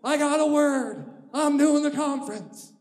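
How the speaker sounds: a high, loud, drawn-out yes; eager agreement. Retold or imitated. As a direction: play it like you just got sure of a word. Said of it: "So I said".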